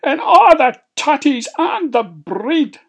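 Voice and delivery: high, loud dwarf yell. Acted. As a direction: yell it in outrage. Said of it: "yelled Glaikit".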